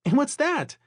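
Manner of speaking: very high, cheery little voice; little Laura's high, chirpy question; cheery chirp. A narrator's reading. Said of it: "chirping in her cheery little voice".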